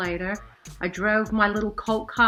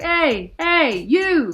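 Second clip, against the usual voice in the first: loud, higher call across the street; 8 semitones higher, 8 dB louder, much slower than usual. Retold or imitated. Guy in the SUV calls out to her on the corner, loud and high. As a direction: shout to get her attention from the car.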